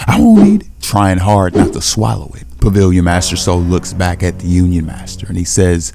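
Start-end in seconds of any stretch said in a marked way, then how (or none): 0.1-0.7 very high, loud mock squeal; frantic refusal. A narrator's reading. Red monkey fights swallowing the poison, frantic high squeal. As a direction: shoot the pitch way up in protest.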